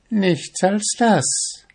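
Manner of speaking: high, slow, drawn-out reply, falling at the end; smiling answer. A narrator's reading. Witch confirming her price to the fisherman, smiling. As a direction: draw it out with a smile. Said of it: "antwortete sie und lächelte ihn dabei an".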